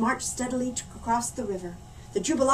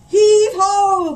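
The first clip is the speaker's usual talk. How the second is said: loud, much higher call; 10 semitones higher, 13 dB louder than usual. Acted. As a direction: haul and call to the crew. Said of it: "called one of the Jubilates".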